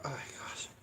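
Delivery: pained whisper. Real, not acted. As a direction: breathe it out in a whisper. Eyes burning from pepper.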